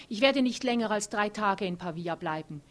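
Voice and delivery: laut und bockig bestimmt; trotzig. A narrator's reading. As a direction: setzt ihren Willen laut dagegen. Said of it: "meint sie nach einer Weile trotzig". (Thea beharrt gegenüber Otto trotzig auf ihrem Plan)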